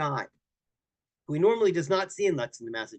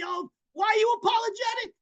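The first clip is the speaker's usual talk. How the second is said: much higher, baffled shout; incredulous mock question. Guest challenging the rabbi, incredulous high shout. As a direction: throw the question back in disbelief.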